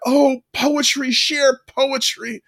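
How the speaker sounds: high, slow, dreamy teacher voice; mock swooning teacher. Podcaster imitating the teacher gushing over poetry. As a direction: gush it like an enchanted teacher. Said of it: "and she's like".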